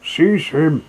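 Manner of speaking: party crying out as they lunge at Toad; angry cry. Acted. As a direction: shout it in outraged alarm. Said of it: "they cried".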